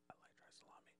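breathy whispered aside about the salami. Real, not acted. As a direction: whisper it like a secret confession. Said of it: "whispered aside".

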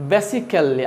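basically said "BESI-kel-li", short first vowel; BASICALLY with every syllable sounded, "be-si-kel-li"; local pronunciation. Shown as a demonstration. Teacher showing how we say it, "besi-kel-li". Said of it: "আমরা কি বলি?".

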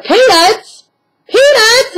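high rising call of Peanuts; calling the dog. Retold or imitated. Owner calling her puppy by a new name, high questioning call. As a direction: sing out the name to get her attention. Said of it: "I just looked at her and said".

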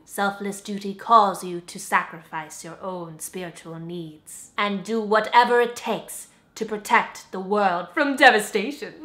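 low, grave counsel; solemn spiritual adviser. Acted. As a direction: impart wisdom with weighty calm.

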